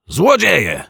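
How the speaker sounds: high, loud cry of alarm; Złodzieje! burst out high and loud; yelled. A narrator's reading. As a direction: shout it out in shock. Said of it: "wrzasnął detektyw Pozytywka".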